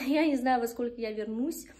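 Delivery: ordinary talk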